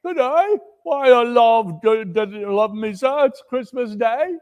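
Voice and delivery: high, lightly voiced boy; a hint of a boy calling up; young street boy. A narrator's reading. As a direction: answer like a cheeky lad. Boy answers Scrooge from street.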